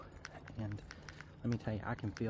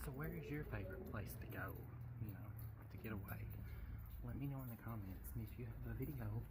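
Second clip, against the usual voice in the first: soft-spoken; 11 dB quieter, faster than usual. Real, not acted. Sign-off asking for comments.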